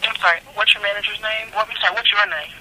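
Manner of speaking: normal talking voice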